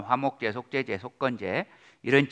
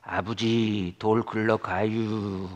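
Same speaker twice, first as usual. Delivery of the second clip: mock Chungcheong drawl, stretched out; slow, lazy Chungcheong dialect; slow Chungcheong accent; much slower than usual. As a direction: imitate a slow Chungcheong farmer's son calling. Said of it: "충청도 사람 말 느리다고요".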